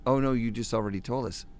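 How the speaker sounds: usual speaking voice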